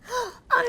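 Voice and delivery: high theatrical gasp; dramatic gasp. Acted. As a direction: gasp before the big reveal. Hedgehog's big reveal.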